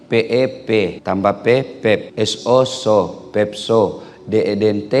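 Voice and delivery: low, halting beginner's reading; spelling out. Acted. Grandmother learning to read from grandchild, halting spelled-out syllables. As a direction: sound it out piece by piece, fairly low.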